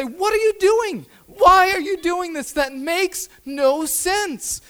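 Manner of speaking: high, loud exasperated protest; yelling at the TV. Retold or imitated. Husband to the talent show judges, exasperated yelling. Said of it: "yelling at the T V".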